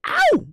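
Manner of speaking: sudden very high, loud "ow!"; playful high-pitched whoop; hyped "ow!" whoop. Retold or imitated. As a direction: yelp it out like a catcall. Reacting to Speedy looking good.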